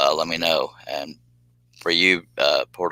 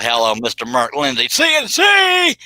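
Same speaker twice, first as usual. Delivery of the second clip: announcer shout; 7 semitones higher, 5 dB louder than usual. Acted. Host hollering a welcome to [name], booming announcer call. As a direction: build to a huge high shouted C N C.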